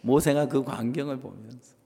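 usual speaking voice

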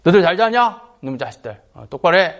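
mock brusque morning greeting; rough up the boys verbally; imitated curt barking at pupils; gruff with boys. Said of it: "남학생들한테".

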